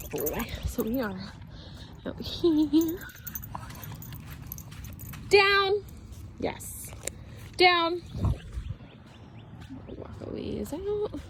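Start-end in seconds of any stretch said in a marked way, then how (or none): 5.3-5.8 high, loud "Down." barked at the dog; sharp command. Real, not acted. Trainer barking Down at her Frenchie, loud and high.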